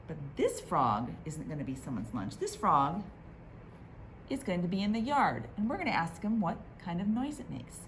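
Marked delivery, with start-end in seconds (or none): none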